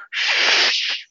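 long mock shush. Moviegoer silencing a whispering amateur sleuth, drawn-out shush. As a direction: hush a chatty moviegoer.

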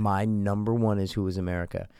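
hushed confiding whisper. Real, not acted. Sharing his top show with a friend, whispered. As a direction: tell him like a secret. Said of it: "whispered top pick".

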